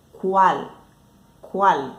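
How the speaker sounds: "cual" ending on a clear l, not a u; final Spanish L. Shown as a demonstration. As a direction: hold a firm l at the end of "cual". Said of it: "palavras que finalizam a sílaba com L".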